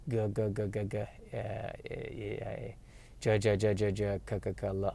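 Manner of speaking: soft, low mutter running through sounds; muttering syllables. Real, not acted. Podcaster hunting a forgotten name, soft low muttering. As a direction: mumble the syllables under your breath.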